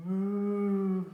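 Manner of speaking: a loud drawn-out moo. Acted. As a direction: sing a big moo. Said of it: "Dog went".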